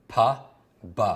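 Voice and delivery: "P, B" as puh, buh; P and B sounds. Shown as a demonstration. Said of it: "Nine is the P and the B sound".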